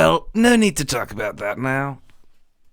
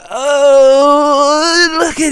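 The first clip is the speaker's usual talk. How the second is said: excited greeting; 10 semitones higher, 12 dB louder, much slower than usual. Acted. Greeting leprechaun loudly.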